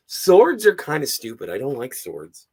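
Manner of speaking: ordinary delivery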